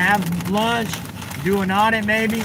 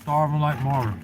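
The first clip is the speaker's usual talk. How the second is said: eating while talking; 3 semitones lower than usual. Real, not acted. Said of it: "while I eat some".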